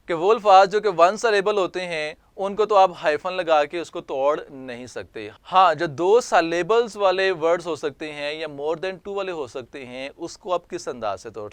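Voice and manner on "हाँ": ordinary talk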